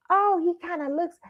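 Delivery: high musing grandma. Retold or imitated. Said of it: "she says".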